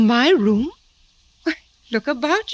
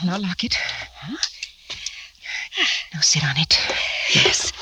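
hushed orders so Papa cannot hear; urgent whisper; 5 semitones lower than usual. Acted. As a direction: whisper quickly to the maid. Hiding lover from father.

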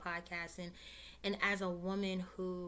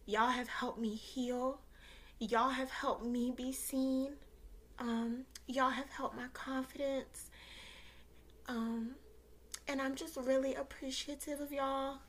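holding back tears; 5 semitones higher than usual. Real, not acted. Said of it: "I'm about to cry".

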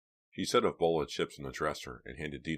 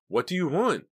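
high, loud angry shout; 13 semitones higher, 8 dB louder than usual. A narrator's reading. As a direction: shout it back angrily. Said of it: "Dina shouted".

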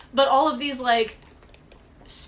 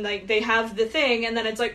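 ordinary delivery

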